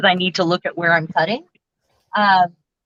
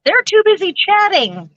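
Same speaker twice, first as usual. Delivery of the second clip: much higher, playful mock complaint; teasing scold; 10 semitones higher than usual. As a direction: squeak it out as a joking gripe. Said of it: "Oh, I'm kidding anyway".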